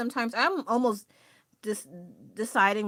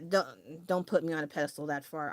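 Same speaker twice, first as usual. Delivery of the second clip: usual speaking voice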